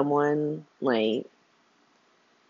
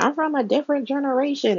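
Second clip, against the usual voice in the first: much higher, fast put-on old man; mock old man; 9 semitones higher, faster than usual. Mocking Hat Daddy dodging Trina's questions, high and fast. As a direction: squeak out the old man's excuse.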